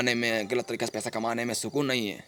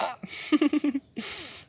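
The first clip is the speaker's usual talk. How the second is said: high tittering laugh; gleeful laugh; 12 semitones higher than usual. Acted. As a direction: chuckle happily in a high voice. Happy after asking for a hug.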